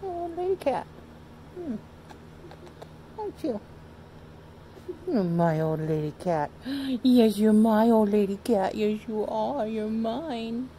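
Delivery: high cooing voice for the cat; doting owner baby-talking her old cat; pet baby talk. Acted.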